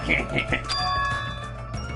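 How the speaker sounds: breathy huh-huh snicker; Beavis snicker. Acted. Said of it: "Beavis voice".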